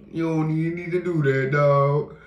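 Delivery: high loud mock voice. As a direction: jump up high and loud like someone else talking. Mock voice after low take.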